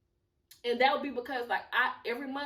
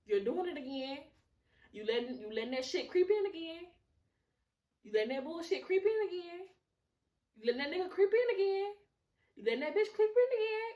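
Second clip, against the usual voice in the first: climbing higher and louder with each repeat; escalating self-scolding; 7 semitones higher, 4 dB louder than usual. Acted. Telling herself off for letting it creep in, escalating exasperation. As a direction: build the mock telling-off to a high, loud peak.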